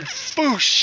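long, airy "foosh" sound effect; imitated whoosh. Joke teller imitates the man letting it rip, long whoosh. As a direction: let out a drawn-out whoosh.

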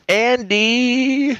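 lightly imitated wife calling; mimicking wife calling out. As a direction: call out the name like his wife does from another room. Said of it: "my wife is calling Andy".

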